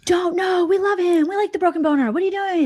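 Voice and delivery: high, pleading fan voice; adoring fans. Retold or imitated. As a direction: defend him like a loyal fan. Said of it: "were like".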